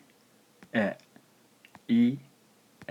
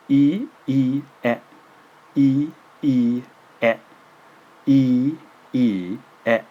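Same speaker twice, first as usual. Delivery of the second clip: each e sounded separately; e e e said one by one; slow syllable drill; 4 semitones higher, 7 dB louder, slower than usual. Shown as a demonstration. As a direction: tap out the e syllables. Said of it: "Can you hear the difference?".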